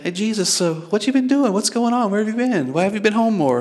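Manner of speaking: acted mother, high and inquisitive; mother questioning son. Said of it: "Mary's like".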